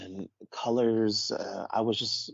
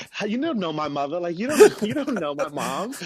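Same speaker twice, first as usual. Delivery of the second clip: much higher and louder, laughing through it at the end; can't believe it, half laughing; incredulous disbelief; 7 semitones higher, 6 dB louder than usual. Retold or imitated. Incredulous retort, rising, laughing.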